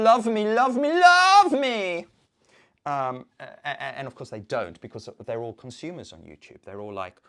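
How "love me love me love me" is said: loud, high begging, drawn out; mock desperate pleading. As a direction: build to a long, needy wail. Mock begging for viewers' love.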